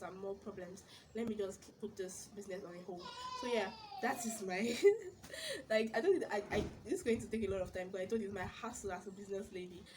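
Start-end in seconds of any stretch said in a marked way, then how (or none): 4.8-5.6 bright burst of laughter; sudden high loud laugh. Real, not acted.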